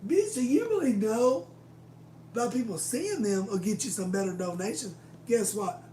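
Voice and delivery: loud, high jeering; scornful outburst. Retold or imitated. Mocking the boss whose store later closed, loud scorn. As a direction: sneer it at her, loud.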